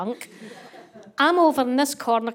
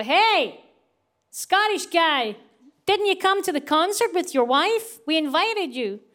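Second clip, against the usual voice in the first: lightly voiced friendly stranger, higher; cheery American guy; 7 semitones higher, slower than usual. Two band guys greeting the husband at the bar, bright and friendly. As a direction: call out brightly to the Scottish guy.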